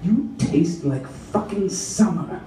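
usual speaking voice

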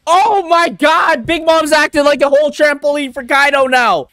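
high astonished yelling; real thrilled outburst; excited shout. Real, not acted. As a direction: holler in amazement. Astonished at fight on screen.

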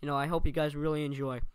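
usual speaking voice

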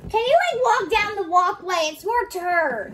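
high, loud call. Real, not acted. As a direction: call her up to the runway. Calling out to sister.

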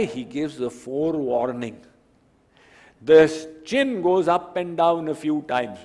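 ordinary talk

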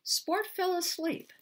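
slow, soothing read-aloud, rising then settling; drowsy bedtime-story close; storybook ending. Acted. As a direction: wind the story down for the kids. Author reads picture book aloud.

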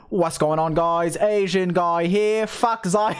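British streamer intro impression; Atsu. Atsu answers the staged beef, British intro impression. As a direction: drawl a mock British hello, then a jab. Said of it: "And they'll be like".

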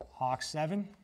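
slow spaced radio call; radio operator call. Shown as a demonstration. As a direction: say each word clearly over the net. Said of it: "So then you go".

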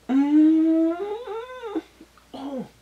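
moved hum. Real, not acted. Moved by beautiful dialogue.

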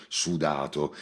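ordinary delivery, nothing marked

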